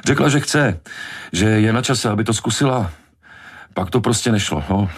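high, halting explanation; desperate. A narrator's reading. Hooper struggling to answer Brody's questions, desperate. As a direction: make up excuses in a hurry, haltingly. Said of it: "Zoufale se snažil udržet tempo".

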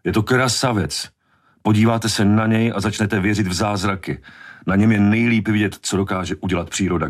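high-pitched, passionate defence; worked-up, insistent admirer; indignant. A narrator's reading. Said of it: "rozčílil se Hooper".

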